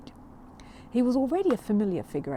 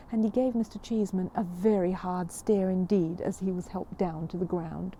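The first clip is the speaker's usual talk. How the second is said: usual speaking voice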